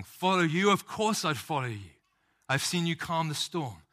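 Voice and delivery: rising, fervent of course I'd follow you; eager disciple. Acted. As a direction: answer like a disciple who has seen every miracle. Imagined disciple's eager reply.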